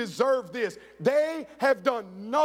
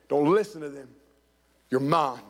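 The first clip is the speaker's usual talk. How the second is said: reassuring father; 9 semitones lower than usual. Retold or imitated. Adoptive father comforts mocked son.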